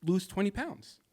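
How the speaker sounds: high mocking voice of a cruel date; mocking dismissive date. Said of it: "to be told".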